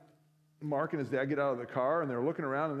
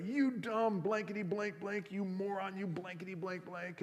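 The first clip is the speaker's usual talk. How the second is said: furious berating; 6 semitones higher, 4 dB quieter than usual. Retold or imitated. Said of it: "He was furious".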